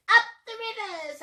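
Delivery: high hoot on UP. A narrator's reading. Reader to children as the happy dinosaur, hooting cheer. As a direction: hoot it out gleefully. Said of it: "And he hooted".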